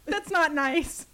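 high, loud and slow protest; hammed-up cry of offense; mock outraged shout. Real, not acted. Cohost shouting at her friend for teasing her.

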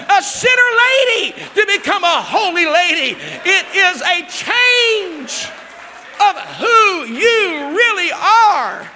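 high, loud, slow preacher's cry; climactic preaching. Acted. Pastor hitting the peak for the church, loud slow cry. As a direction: stretch each word at the peak.

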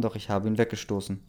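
ordinary delivery, nothing marked

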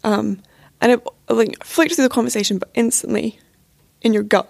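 ordinary delivery

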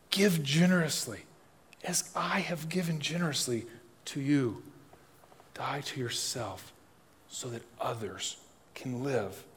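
soft, slow and near a whisper in places; gentle voice of Jesus. Acted. Pastor voicing Jesus' teaching to his hearers, soft and slow. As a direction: lay each command down quietly and tenderly.

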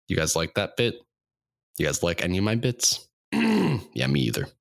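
normal talking voice

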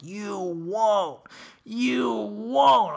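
high, drawn-out taunt from the crew; imitating goading friends; hyped-up dare. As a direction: dare him, stretching the words upward. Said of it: "they're all hyped up".

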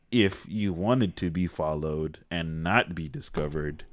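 very slow, measured recital; slow film-line delivery. Retold or imitated. McAvoy's character explaining himself, slow measured delivery. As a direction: space the words out portentously, a little subdued.

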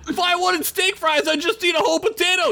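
high, fast goblin rant; a rushed, squeaky goblin complaint; yelling goblin. Acted. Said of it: "yelled a goblin".

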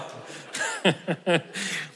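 long unvoiced giggle; can't stop chuckling at himself; real soft laughter; breathy laugh. Real, not acted. Laughing at own joke.

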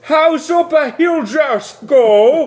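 very high, loud, slow odd voice; future lingo. Pretend. Friend translating Corey's sentence into future lingo. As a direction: chant it like garbled future speech. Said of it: "I'll say it in future lingo".

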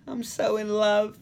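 high, slow, drawn-out swoon; mock lovestruck. [name] telling her friends, mock lovestruck. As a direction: swoon it like a woman besotted.

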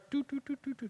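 soft hummed "doo, doo, doo" like a descending lift; elevator noise. Retold or imitated. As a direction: hum the elevator sliding down under your breath. Imitating an elevator coming down.

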